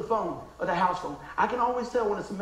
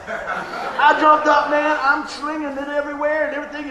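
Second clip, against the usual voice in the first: much louder and higher, laughing; bursting with joy; jubilant; 7 semitones higher, 8 dB louder than usual. Real, not acted. Pastor celebrating her faith in the hospital room, jubilant shouting.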